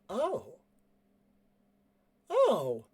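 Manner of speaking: high, drawn-out oh; high falling exclamation; dawning realisation. Acted. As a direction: let the realisation land slowly. Character pauses, realisation dawns.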